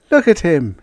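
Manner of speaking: bright, eager delighted voice; thrilled to show it off. Acted. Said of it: "he cried delightedly".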